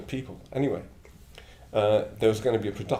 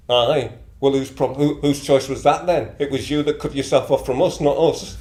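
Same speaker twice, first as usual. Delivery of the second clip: loud, higher, blunt northern comeback; blunt Middlesbrough retort; 4 semitones higher, 7 dB louder than usual. Retold or imitated. Family member snapping back at the returning son, loud and blunt. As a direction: fire it back at him, no softening. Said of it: "that's what the people from Middlesbrough are like".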